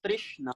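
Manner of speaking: Trishna said "TRISH-na". Shown as a demonstration. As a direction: say Trishna with an i after the r. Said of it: "R I sound".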